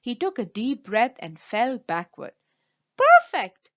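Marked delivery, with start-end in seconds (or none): 3.0-3.7 loud, much higher perfect; an excited baker shouting with joy; delighted shout. Acted. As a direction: cry out in triumph. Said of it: "said Pete".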